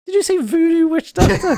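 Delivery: high, loud disbelieving shriek; incredulous squeal. Real, not acted. Incredulous reaction to absurd plot idea.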